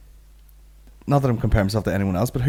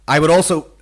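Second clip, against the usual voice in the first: stiff booming robot; 7 semitones higher, 9 dB louder than usual. Retold or imitated. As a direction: read it out like a metallic robot. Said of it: "I read this out of my robot voice".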